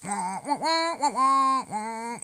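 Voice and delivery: put-on wah wah baby whine, pitch bouncing; mocking crybaby wail; mock crying.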